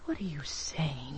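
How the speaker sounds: breathless whisper of disbelief; shocked whisper. Acted. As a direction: hiss it out, stunned. Shocked at detective's implication.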